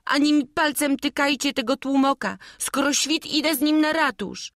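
high, loud, furious outburst; retold angry yell. Jasiek shouting back at the thief, high and angry. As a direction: spit it out in defiance. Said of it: "wrzeszczę ze złością".

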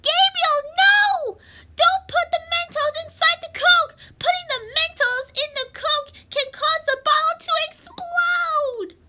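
high, urgent cry to stop; pretend alarmed warning. Warning Gabriel about exploding Coke, high alarmed cry. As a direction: call out a play-panicked warning.

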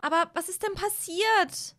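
high-pitched worried question; concerned asking. Acted. Billy asks Hazel through the door, concerned. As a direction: ask with the pitch lifted up.

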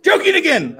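very high-pitched playful yell; joking yell. Pretend. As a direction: yell it out for fun. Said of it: "I just like yelling".

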